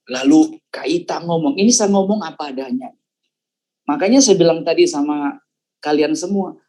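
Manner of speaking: usual speaking voice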